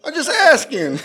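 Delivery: loud, high mock Boston accent. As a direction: ham up the Boston accent, laughing. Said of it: "Fucking Boston accent".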